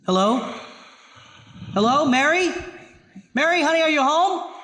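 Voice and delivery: high calling through the house. Acted. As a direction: call for her, uneasy.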